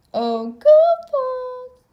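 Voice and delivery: very high, loud, slow drawn-out call; long goofy sing-song call. A narrator's reading. Goofy calls his helper Goofles, long high cry.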